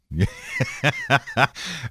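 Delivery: high-pitched burst of laughter; real giggling fit at his own joke; laughing. Real, not acted. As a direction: crack up helplessly. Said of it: "I'm laughing at this".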